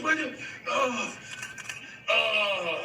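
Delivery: high, slow mock moan; flustered blanking. Bit player blanks before Harrison Ford again, mock flustered moan. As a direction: falter and whimper, imitating a forgotten line. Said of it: "Cut, forgot his line".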